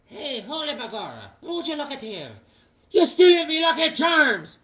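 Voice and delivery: high cartoon Irish accent that builds to a shout; a leprechaun in a stage Irish accent, ending in a shout. Tom Thumb as leprechaun parody.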